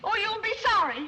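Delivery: high, loud pouting warning; petulant threat. Acted. Rejected girl warns the butler, petulant threat.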